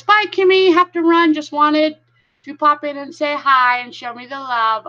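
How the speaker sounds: a hint of a cheery friend rushing off; quoting a viewer. Streamer reads a viewer's goodbye in a light, high mock voice. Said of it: "Sanwana says".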